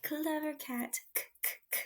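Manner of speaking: soft airy k sounds; clever cat sound. Shown as a demonstration. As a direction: whisper the k sound. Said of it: "letter sound demo".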